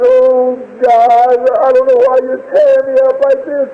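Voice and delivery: high, sustained grieving plea; anguished prayer. Retold or imitated. Father praying for his dying child, anguished pleading. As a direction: beg God with a breaking heart.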